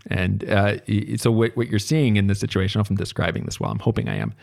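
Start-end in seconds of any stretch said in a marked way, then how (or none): none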